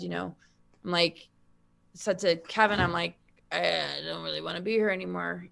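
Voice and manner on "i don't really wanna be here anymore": weak defeated quote. Retold or imitated. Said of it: "that's so weak".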